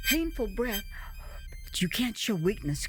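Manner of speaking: plain reading voice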